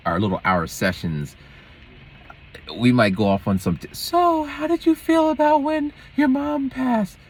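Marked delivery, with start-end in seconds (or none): 3.9-7.2 high, slow, drawn-out mock questioning; mimicking therapist. Voices the therapist's question, mockingly.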